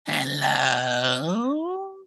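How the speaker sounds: high quavering crone call; old witch voice. Pretend. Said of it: "pretend like I'm the old witch".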